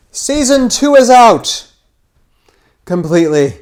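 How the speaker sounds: loud, high, stretched-out proclamation; excited announcement. Real, not acted. Host announcing the series' new season, loud proclamation. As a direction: shout the big news out slowly.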